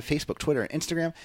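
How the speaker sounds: ordinary talk, nothing marked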